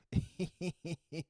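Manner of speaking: high, loud put-on laugh; Marc Maron laugh. Podcast host greeting a guest, imitated high laugh. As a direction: fake a high host's chuckle. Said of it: "Start doing the Marc Maron laugh".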